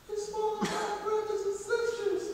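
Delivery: put-on high, soft child's voice; tiny sweet boy calling out; pretty little voice. Little Zeus calling Kronos, high and soft. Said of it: "with his pretty little voice".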